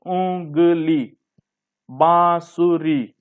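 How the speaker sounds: उंगली, बांसुरी very slowly, syllable by syllable; careful drawn-out model of उंगली, बांसुरी; nasalised vowel demonstration. Shown as a demonstration. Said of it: "नाक की भी सहायता लेते हैं".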